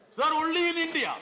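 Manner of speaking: loud, high oratorical outburst; emphatic exclamation. Real, not acted. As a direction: ring it out to the whole chamber. Emphatic answer to own rhetorical question.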